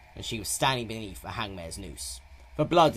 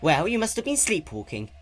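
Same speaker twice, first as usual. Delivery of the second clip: Twilight gently teasing; 9 semitones higher, 6 dB louder than usual. A narrator's reading. Twilight reassures Spike.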